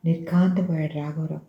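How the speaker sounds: ordinary delivery, nothing marked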